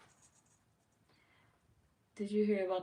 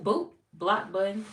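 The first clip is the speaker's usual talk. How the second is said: ordinary talk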